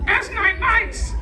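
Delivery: slow, very high parrot voice; mimicking parrot. Parrot puppet echoing his ventriloquist's scolding, slow high mimicry. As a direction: parrot it back in a drawn-out squeaky voice.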